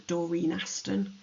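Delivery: ordinary talk, nothing marked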